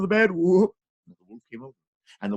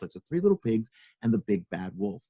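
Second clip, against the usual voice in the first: ordinary delivery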